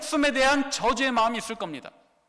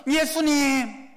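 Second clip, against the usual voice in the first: long, drawn-out higher call; shouted call; 4 semitones higher, 4 dB louder, much slower than usual. Acted. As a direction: call his name across the crowd. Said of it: "또 외칩니다".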